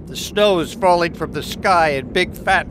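high-pitched, whiny grumbling; groaning complaint. A narrator's reading. Boy looks out at horrid snow, groaning complaint. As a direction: complain loudly about it, pitch up. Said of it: "groaned Harry".

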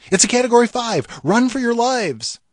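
hammed-up forecaster crying danger; mock panic warning. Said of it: "Or, much worse".

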